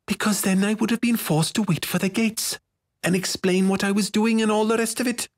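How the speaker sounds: put-on high, impatient girlish explanation; terse. A narrator's reading. Girl explaining to her nosy friend, terse. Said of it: "answered Minnow tersely".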